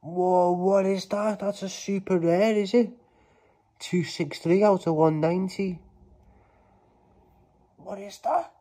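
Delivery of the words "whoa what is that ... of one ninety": high, loud, thrilled outburst over a rare pull; can't believe the card; excited. Real, not acted. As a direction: gasp it out, higher and louder. Pulling a super rare card.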